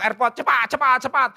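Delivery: high, loud, urgent hurrying; hurried, rushing spouse. Acted. (buru-buru, mendesak dengan suara tinggi)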